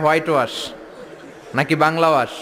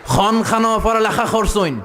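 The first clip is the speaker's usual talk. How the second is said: put-on Sylheti accent; 6 semitones higher, 3 dB louder than usual. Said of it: "Sylheti এক লোক বসছে".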